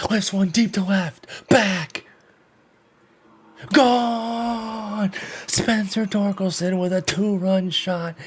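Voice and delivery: hushed yell, pitched high and excited; whisper yelling. Real, not acted. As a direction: yell it under your breath, way up high. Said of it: "so you're gonna get the whisper yell".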